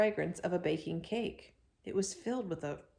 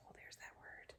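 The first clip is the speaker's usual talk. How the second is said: whisper; 24 dB quieter than usual. A narrator's reading. Whispered aside about patience.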